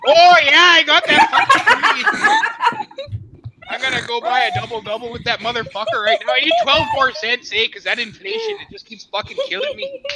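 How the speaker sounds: put-on Canadian accent. Streamer answers friend's toonie question, hammed-up Canadian accent. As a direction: lay on the Canadian accent with laughter underneath. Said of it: "More Canadianness".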